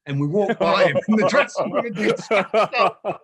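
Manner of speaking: loud, high, laughing through the words; real laughter at the memory. Real, not acted. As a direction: crack up while telling it. Laughing while retelling the chaos.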